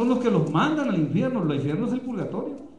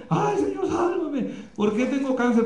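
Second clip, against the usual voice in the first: imitating a whining sick smoker, pitched up; mock crying smoker; 6 semitones higher than usual. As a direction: mocks the smokers' lament. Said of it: "luego están llorando". (imitación burlona de un fumador que llora)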